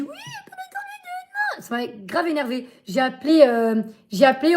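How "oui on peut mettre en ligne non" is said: high, soft mocking voice of the phone agent; high, hushed mock customer-service voice; mocking the operator. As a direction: squeak it out like a dismissive clerk. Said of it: "On m'a dit".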